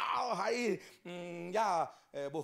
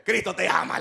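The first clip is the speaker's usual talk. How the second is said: louder, proclaiming Cristo te ama; emphatic; 2 semitones higher, 13 dB louder than usual. Acted. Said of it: "¿Se acordó que era creyente?".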